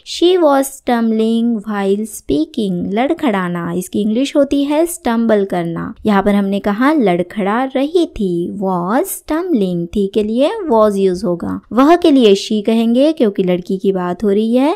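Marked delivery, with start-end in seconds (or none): 0.1-2.8 very slow, each word clear; slow model sentence. Shown as a demonstration. Teacher reading the example sentence to students, very slow. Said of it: "teacher models English sentence". 8.6-9.9 very slow, every word laid out clearly; slow model sentence. Shown as a demonstration. Teacher reading the example sentence to students, very slow. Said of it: "teacher models English phrase".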